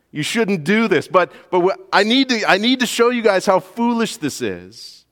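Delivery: high, loud earnest plea; Paul's exasperated voice. Retold or imitated. Preacher voicing Paul's exasperated plea, high and loud. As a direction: voice Paul pleading to show folly. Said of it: "He says".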